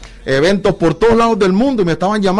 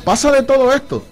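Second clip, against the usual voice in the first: emphatic high; 10 semitones higher than usual. Acted. Repeated phrase, first much higher.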